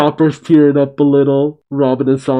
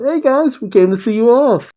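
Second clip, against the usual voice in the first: high, eager call to departing friends; crying out; 10 semitones higher than usual. A narrator's reading. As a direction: call out in a raised, high-pitched voice. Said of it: "Yamato just cries out".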